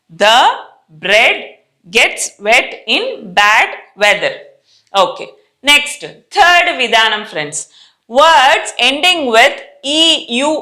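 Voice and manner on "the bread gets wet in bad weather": slow, word-by-word sentence for repeating; slow model reading. Shown as a demonstration. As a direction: spell out each word slowly for the class. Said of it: "first నేను చదువుతాను వినండి".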